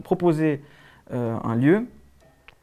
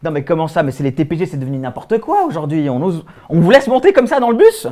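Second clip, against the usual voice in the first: indignation building; 4 dB louder than usual. Acted. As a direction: start put out, end exclaiming in indignation. Joue un passager indigné. (indignation qui monte)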